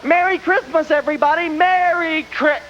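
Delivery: mock festive cry cut short; festive cheer. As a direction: sing out the holiday wish then stop dead. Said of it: "Something really festive".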